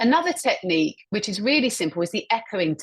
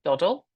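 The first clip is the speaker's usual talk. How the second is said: "Doddle?" with a rising end; questioning tone. Shown as a demonstration. Teacher echoes a colleague's word, questioning rise. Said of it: "repeating the phrase back with a questioning tone".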